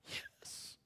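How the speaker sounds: barely audible whispered yes. Retold or imitated. Hurting girl admitting it to the pastor, whispered. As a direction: let it out as a tiny breath. Girl answers about hurt.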